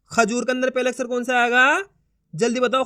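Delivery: ordinary talk, nothing marked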